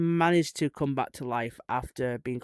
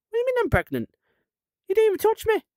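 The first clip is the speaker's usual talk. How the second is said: much higher and louder, squealing disbelief; shocked Mary squeal; 6 dB louder, much faster than usual. Retold or imitated. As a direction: squeal it like a stunned young woman.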